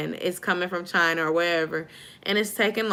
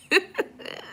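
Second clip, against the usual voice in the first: amused laugh; 13 semitones higher than usual. Real, not acted. Host hears snoring from the couch, high giggle.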